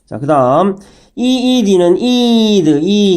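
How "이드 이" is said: 이 held long in 이드; long vowel. Shown as a demonstration. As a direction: stretch out the 이 of 이드. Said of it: "길게 발음합니다".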